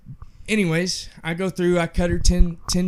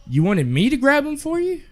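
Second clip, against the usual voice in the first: higher, pointed sarcastic question; mock incredulous; 11 semitones higher than usual. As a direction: ask with fake disbelief. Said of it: "I just kinda was like".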